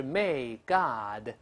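may God bless you, slow word by word; full careful form. Shown as a demonstration. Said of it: "写全了是".

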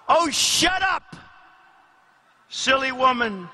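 high, scornful dismissal; villain's mocking put-down; gloating retort. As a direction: cut the victim off with contempt. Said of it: "said the reptile with a grin".

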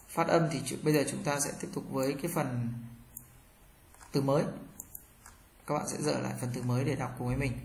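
ordinary delivery

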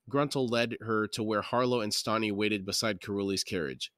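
ordinary delivery, nothing marked

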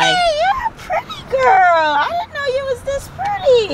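high, gushing pet voice; high-pitched, adoring greeting. Real, not acted. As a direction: coo to an adorable dog. Cooing to the dog.

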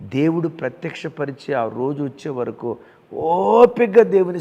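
loud sermon exhortation; preaching. Acted. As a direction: press the promise home to the flock. Pastor preaching to congregation.

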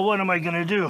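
ordinary talk

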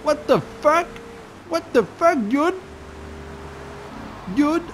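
high, rising startled English cry; mock startled victim. Player voicing the run-over passerby, high mock startled cry. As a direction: squeak it out like a run-over pedestrian. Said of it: "T'as eu le temps de dire".

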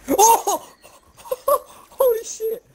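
high laughing shriek. Real, not acted. Shocked laugh in fight.

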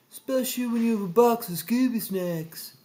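eager high-pitched cartoon slacker; Shaggy. A narrator's reading. Shaggy chiming in about a box of snacks, eager.

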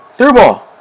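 high, loud call for a pass; urgent call. Real, not acted. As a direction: shout it high to a teammate. Calling for a pass.

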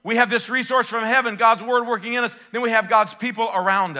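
loud, high, rousing preaching; full-force preaching. Acted. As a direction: lift the congregation up.

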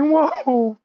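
loud, squeaky gremlin voice; hammed-up alarmed creature squeal; Gizmo. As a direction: protest in a tiny high voice. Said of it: "He's like".

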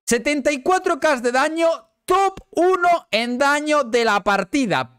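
high, slow proud listing; boasting. Real, not acted. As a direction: savor each stat. Boasting about damage. (presumiendo, agudo y lento)

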